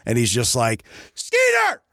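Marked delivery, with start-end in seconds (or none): 1.1-1.8 high, strained yell; shouted threat. Retold or imitated. Alex ordering Skeeter out of the wood line, shouted threat. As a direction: call out a warning across a distance, pitch way up.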